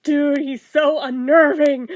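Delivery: loud, slowed, laughing through it; cracking up while saying unnerving; laughing exclaim. Real, not acted. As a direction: blurt it out half laughing.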